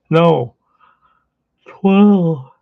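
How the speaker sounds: loud, high emphatic correction. Real, not acted. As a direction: nearly shout the correction.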